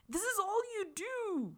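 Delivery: high, put-on mock female voice; imitated girlfriend. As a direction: squeal it high like the teasing girlfriend. Acted retold girlfriend in a video.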